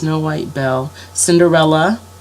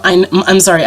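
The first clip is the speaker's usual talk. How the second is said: ordinary delivery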